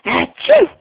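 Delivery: loud, high-pitched "achoo!"; fake sneeze. Shown as a demonstration. Mother showing toddler a sneeze, playful fake sneeze. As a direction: do a big pretend sneeze.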